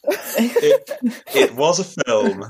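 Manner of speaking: laughing through the words. Real, not acted. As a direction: giggle it out slowly. Amused dry agreement.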